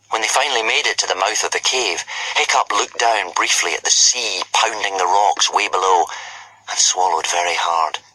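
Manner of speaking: plain reading voice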